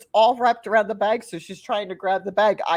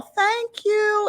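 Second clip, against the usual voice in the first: high mock-yell of little kids; kids yelling thanks; 14 semitones higher than usual. Kids thank her for cake pops, mock yell. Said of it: "And they all yell".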